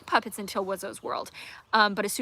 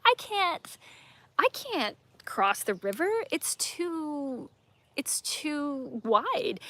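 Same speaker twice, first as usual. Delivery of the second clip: put-on scared kid; 6 semitones higher, much slower than usual. Scared kids refuse to cross.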